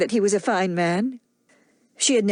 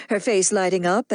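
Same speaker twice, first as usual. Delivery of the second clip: ordinary delivery, nothing marked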